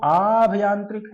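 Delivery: आभयांत्रिक with a long, loud opening aa; slow, emphatic long aa starting आभयांत्रिक; stretched spelling option. Shown as a demonstration. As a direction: stretch the first vowel of आभयांत्रिक. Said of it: "शुद्ध शब्द बताना है".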